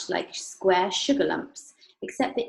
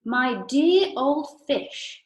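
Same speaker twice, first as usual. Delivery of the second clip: very slow, high and louder; Mister Wonka's voice; 6 semitones higher, 6 dB louder, much slower than usual. Acted. Mister Wonka teasing Missus Salt, slow, high and louder. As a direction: draw it out, mock-grand.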